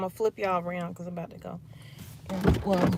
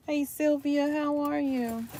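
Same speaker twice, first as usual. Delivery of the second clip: bright greeting; 7 semitones higher, slower than usual. Real, not acted. Vlogger greeting a viewer in chat, cheery and higher. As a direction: sing out the hello.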